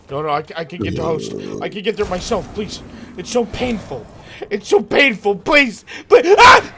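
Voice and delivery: desperate, rising cries of pain; pained begging. Acted.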